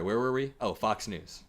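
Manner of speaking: normal talking voice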